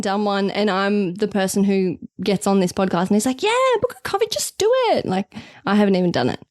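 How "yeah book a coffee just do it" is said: high, breezy cheerleading send-up of oneself; mock your own peppy advice, pitched way up; exaggerated high-pitched encouragement; mock pep talk. Mimics own podcast pep talk.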